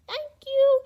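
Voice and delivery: high squeaky mouse thanks; squeaky mice. Acted. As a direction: squeak it like grateful little mice. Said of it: "they squeaked".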